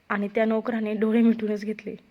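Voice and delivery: ordinary delivery, nothing marked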